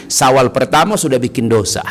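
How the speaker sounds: ordinary delivery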